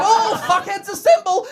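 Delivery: loud, high, drawn-out battle summons; mock supervillain roar; villain rallying cry. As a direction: call the minions to assemble, slow and loud. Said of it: "and he said".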